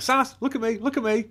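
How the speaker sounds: high-pitched sing-song calling to a pet; coaxing the dog. Retold or imitated. Owner calling her dog to face the camera, high coaxing voice.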